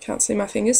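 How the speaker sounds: ordinary talk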